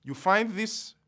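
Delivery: normal talking voice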